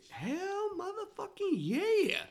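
high, slow mock heckler yell; drunk heckler shout. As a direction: imitate a drunk guy bellowing. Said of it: "He kept saying something like".

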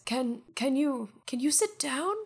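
put-on a touch of a nervous old man asking; uneasy polite request in a put-on voice; lightly voiced miser.

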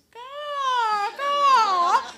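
loud, high crow cawing. Retold or imitated. Storyteller imitating the crow calling the others to attack, loud high caws. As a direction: screech the crow's call.

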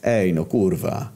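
slow, drawn-out exasperated curse; mildly impatient, stretching the words; losing patience; growing impatient. A narrator's reading. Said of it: "zaczął się niecierpliwić".

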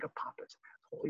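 dropped to a whisper. Real, not acted. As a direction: whisper the insult. Whispered aside after laughter.

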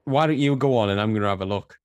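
ordinary talk

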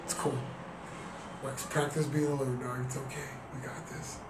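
telling himself in his head it's okay, self-reassuring. Retold or imitated. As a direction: soothe yourself quietly, letting it fade. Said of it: "thinking to myself".

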